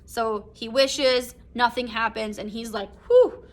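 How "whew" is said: high loud mock sigh of relief; relieved whew. Husband relieved the wish failed, loud mock whew. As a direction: blow out a big relieved whew. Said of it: "sense of relief".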